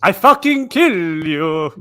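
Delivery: hammy Eastern European threat; loud Eastern European accent, high and angry. Pretend. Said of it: "he spoke in an Eastern European accent".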